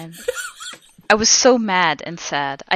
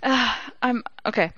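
plain reading voice

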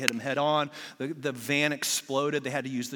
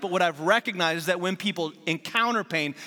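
louder, higher, driving sermon voice; preaching; 4 semitones higher, 4 dB louder than usual. Acted. Pastor pressing the point to his congregation, preaching. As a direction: preach it with conviction. Preaching builds to full force.

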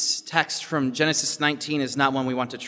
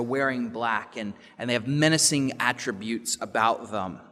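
ordinary talk

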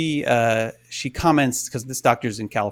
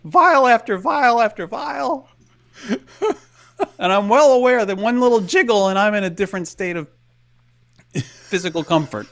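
rising anxious disbelief; 8 semitones higher than usual. Real, not acted. Anxious about the needle.

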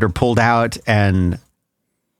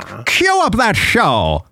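high, drawn-out bad film-star impersonation; mock a gangster-movie star, stretching the words; Edward G. Robinson; 7 semitones higher, slower than usual. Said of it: "talking like a bad impersonation of Edward G".

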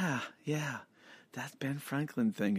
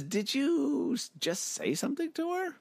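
hammy stage actor; 13 semitones higher than usual. Acted. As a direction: ham it up like an old stage actor. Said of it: "Amos says".

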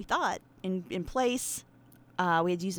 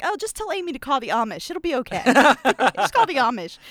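mock advice; 4 semitones higher, 7 dB louder than usual. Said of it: "it's like".

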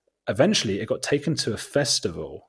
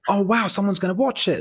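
high, loud burst of excitement; light up with mock-naive hope; retold excited hope; 12 semitones higher, 5 dB louder than usual. Filmmaker each week hoping for viewers, excited high burst.

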